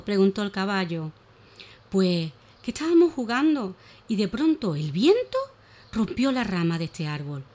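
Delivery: put-on lively, animated reading of a children's tale; expressive children's story voice; storytelling for children. A narrator's reading. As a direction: tell it like a bedtime story for little ones.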